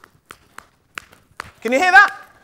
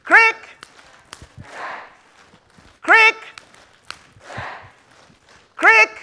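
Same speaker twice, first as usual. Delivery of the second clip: high rising call of crick; 9 semitones higher, slower than usual. Shown as a demonstration. Storyteller calling crick for the kids to answer. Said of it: "when I say crick".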